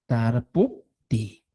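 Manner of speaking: "Tar pu ti" slow, each syllable apart; slow classroom syllable drill. Shown as a demonstration. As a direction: spell it out slowly in syllables. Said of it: "aquí hemos puesto".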